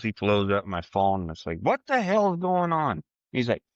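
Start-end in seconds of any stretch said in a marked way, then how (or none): 1.6-3.0 high, hyped-up retelling of a frantic caller; Dion's frantic call, high and excited. Retold or imitated. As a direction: imitate an overexcited friend blowing up your phone. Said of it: "Dion was absolutely plumb excited".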